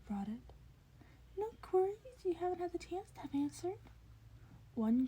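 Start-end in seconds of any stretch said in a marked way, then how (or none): 1.4-3.8 high, curious creature voice; eager high questioning; curious Nezu. A narrator's reading. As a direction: probe him like a keen little principal.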